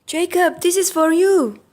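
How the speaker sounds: put-on high girly fan voice. Schoolgirl hands Jacob a present, high and gushing. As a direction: gush at the boy you adore. Girl fans giving presents.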